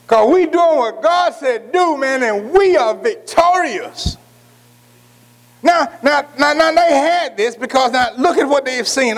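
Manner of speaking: slow, high preacher's build; rising fervour, drawn out; preaching. Acted. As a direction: lift the congregation slowly.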